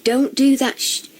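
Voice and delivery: normal talking voice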